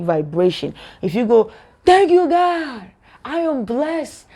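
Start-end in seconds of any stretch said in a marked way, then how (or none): 1.8-4.3 high, drawn-out joyful praise; heartfelt gratitude. Shown as a demonstration. Believer thanking God for blessings, heartfelt and exultant. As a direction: sing out your thanks, stretched and high. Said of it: "you feel it within your heart".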